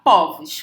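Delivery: povos with open stressed o, "póvos". Shown as a demonstration. Teacher deducing the plural, open o in povos. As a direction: say povos with first vowel as ó. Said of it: "com timbre aberto".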